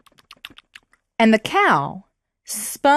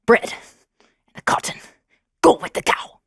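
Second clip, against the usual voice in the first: hushed stepmother order; 2 semitones higher than usual. Acted.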